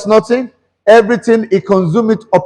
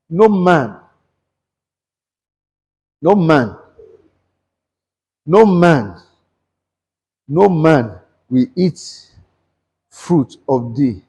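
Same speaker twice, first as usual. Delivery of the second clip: solemn curse; 5 semitones lower, 4 dB quieter, slower than usual. Acted. Jesus's curse on the fig tree.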